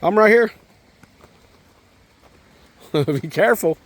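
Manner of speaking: ordinary delivery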